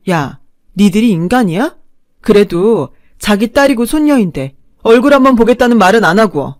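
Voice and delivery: wife yelling at husband and mother-in-law; angry retort. Acted. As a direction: throw it back at them. Wife fighting back.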